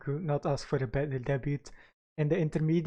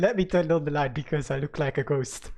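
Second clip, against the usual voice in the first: ordinary talk, nothing marked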